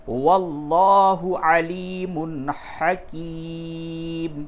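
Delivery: slow, high chanted tajweed recitation; Quran recitation. Shown as a demonstration. Teacher chanting the verse for students, slow and melodic. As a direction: intone the verse slowly and melodically. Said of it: "آپ اس کو پورا کیسے پڑھیں گے".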